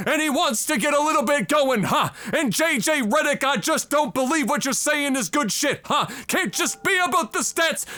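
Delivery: hammed-up Stephen A. preacher voice; southern preacher impression. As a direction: call out to the congregation for an amen. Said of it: "a little bit of a southern preacher".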